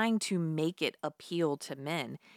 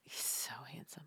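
breathy swooning whisper; 10 dB quieter than usual. Real, not acted. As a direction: sigh it out dreamily. Swooning over Jude Law.

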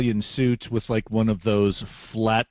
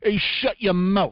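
high, indignant Italian-American put-down; Italian-American accent; 9 semitones higher than usual. Acted. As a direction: shut them up like a Bronx wise guy. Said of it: "Can't do an Italian accent".

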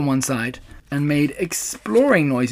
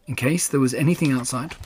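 ordinary delivery, nothing marked

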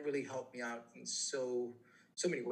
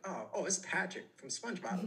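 lightly voiced cartoon character, higher pitched; a hint of goofy cartoon voice; SpongeBob; 6 semitones higher than usual. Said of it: "like a SpongeBob type of voice".

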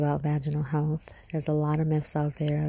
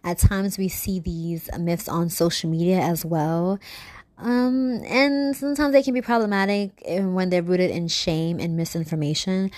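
intro voiceover; 3 semitones higher, 5 dB louder than usual. Acted. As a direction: announce the episode teaser. Recorded intro teaser.

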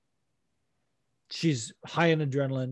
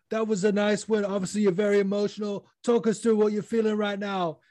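put-on interviewer voice, pitched up; Bisping; 5 semitones higher than usual. Bisping interviewing the emotional winner, put-on higher voice. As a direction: play the post-fight interviewer, higher than usual. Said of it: "but Bisping was like".

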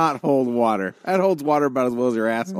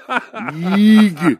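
disgusted yeeg; 7 semitones higher, 9 dB louder than usual. Real, not acted. Disgusted at piano teacher house.

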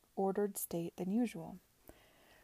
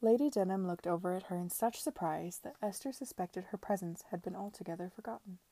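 ordinary delivery, nothing marked

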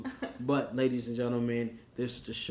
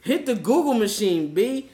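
hyped; 8 semitones higher, 9 dB louder than usual. Real, not acted. Hyped plug continues.

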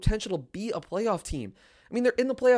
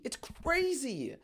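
much softer, slow, trailing off; hushed awe; 6 semitones higher, 9 dB quieter, much slower than usual. Real, not acted. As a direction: let it fade to a hushed murmur. Repeats it, trailing off.

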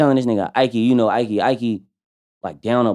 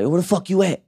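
eager call; 10 semitones higher, faster than usual. Retold or imitated. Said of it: "I be like".

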